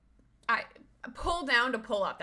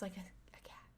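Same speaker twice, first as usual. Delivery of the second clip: unvoiced, softer whispered aside; 3 semitones lower, 15 dB quieter, much faster than usual. Real, not acted. Owner whispering about her dog, hushed. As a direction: whisper so he won't hear.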